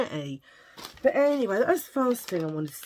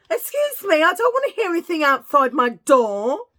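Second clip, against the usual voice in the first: much higher and louder, scolding; real shout; 10 semitones higher, 11 dB louder than usual. Real, not acted. A mum yelling at the kids outside her door to stop.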